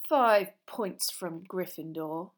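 slow, loud pronouncement; stern Snape. Acted. Snape docks points from Gryffindor, slow and stern. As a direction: pass sentence slowly and firmly.

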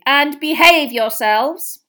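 loud, slow, high warning; drawn-out motherly order; calling after. A narrator's reading. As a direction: call it after people walking away. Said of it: "she called after the twins' retreating backs".

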